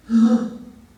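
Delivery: loud, sudden gasp; sharp intake of surprise; shocked gasp. Acted. Bear shocked as he turns human.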